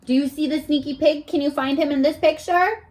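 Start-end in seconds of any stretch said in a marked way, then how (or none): none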